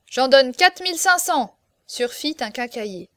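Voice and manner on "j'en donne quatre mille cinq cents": high, loud bid; outbidding call. A narrator's reading. Hardware dealer outbidding the apothecary, loud eager call. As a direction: call out over the other bidders.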